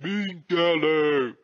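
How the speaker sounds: high, slow pained cry; high drawn-out surrender; Piggy Billa crying out. A narrator's reading. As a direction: wail it out as the spears strike. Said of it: "Piggy Billa cried aloud".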